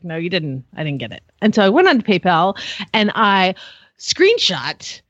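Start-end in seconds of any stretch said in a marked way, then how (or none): 0.0-1.2 lower, much softer, matter-of-fact; mild retold puzzled friend; quiet flat reply. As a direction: say it low and quiet. Friend says money never arrived.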